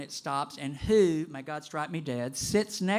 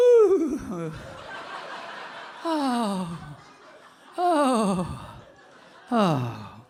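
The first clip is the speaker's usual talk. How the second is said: high, blissful oohs and ohs, louder; senator getting high; 4 semitones higher, 4 dB louder, much slower than usual. Retold or imitated. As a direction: voice a dozing old man savoring the smoke. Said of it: "he was going".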